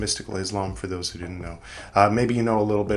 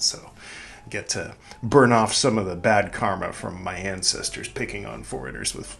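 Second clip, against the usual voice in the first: normal talking voice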